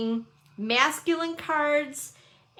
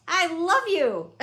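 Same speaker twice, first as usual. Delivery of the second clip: laughing it out, a touch slower; 6 semitones higher, slower than usual. Real, not acted. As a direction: say it through a real giggle to the viewers. Thanking viewers for the hearts.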